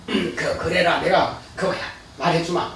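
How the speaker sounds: mock agreeable Jesus. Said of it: "예수님께서 뭐라고 하셨을까요".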